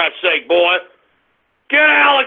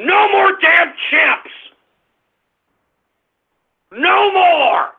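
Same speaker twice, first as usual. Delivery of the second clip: real furious rant; 6 semitones higher than usual. Real, not acted. Host scolding prank callers who may get him off air, furious shout. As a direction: yell it in outrage, stretching the key words.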